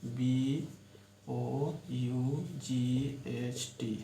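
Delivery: letters read slowly one by one; dictating letters slowly; spelled out slowly. Shown as a demonstration. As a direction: spell the word slowly for the class. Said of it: "जैसे बॉट".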